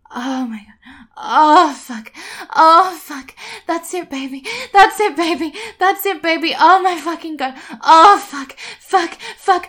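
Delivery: loud climax moans; crying out. Acted. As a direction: cry out in ecstasy.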